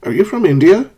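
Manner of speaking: "Are you from India?" rising high at "India"; questioning rise. Shown as a demonstration. Teacher voicing someone unsure of origin, questioning rise. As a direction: ask openly, lifting the end of "India". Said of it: "You're saying".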